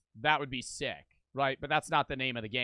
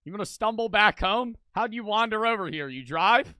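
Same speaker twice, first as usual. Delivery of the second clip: put-on loud, teasing scolding of a drunk boss; mocking cop talking down; 5 semitones higher, 5 dB louder than usual. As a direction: talk down to him like a cop. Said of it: "I'd be like".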